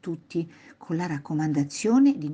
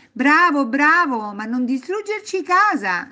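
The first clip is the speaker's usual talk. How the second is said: high, loud, playful praise; cheer, then teasingly scold; amused cheering; 9 semitones higher, 8 dB louder than usual. A narrator's reading. Grandparents to boy who slammed door, amused loud cheer. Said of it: "risposero i nonni divertiti".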